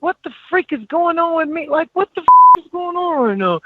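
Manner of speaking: high, loud, panicked outburst of disbelief; panicked cry. Retold or imitated. Crying on the field to himself, panicked and loud. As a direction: shout it like you're losing it. Retold crying breakdown at practice.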